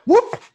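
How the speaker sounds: high, loud heave-ho whoop; effortful whoop. Retold or imitated. Game master voices the players hoisting the orc with a high whoop. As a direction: yelp it out as you hoist.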